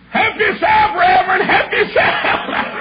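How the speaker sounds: high, loud, laughing encouragement; laughing shout. Retold or imitated. Policeman urging the reverend to stay, high laughing shout. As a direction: egg the preacher on joyfully.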